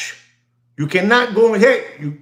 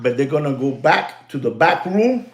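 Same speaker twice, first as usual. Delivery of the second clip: normal talking voice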